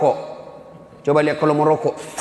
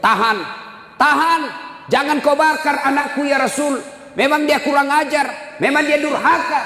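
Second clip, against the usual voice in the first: mother screaming; 10 semitones higher than usual. Acted. Mother shouting to the Prophet to spare her son. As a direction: cry out to stop them. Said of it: "ibunya berteriak".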